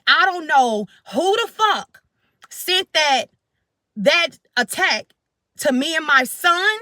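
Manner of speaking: retold screaming plea. Livid mother telling ancestors of the attack, retold screaming. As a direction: demand it, mildly re-enacting the screamed anger. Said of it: "I'm screaming".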